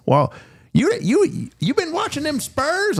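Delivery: put-on very high, slow old lawman voice; Roscoe the cop. Cop asking the driver about the Spurs, in a very high voice. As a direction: pitch way up like a folksy country sheriff. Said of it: "He sounded just like that".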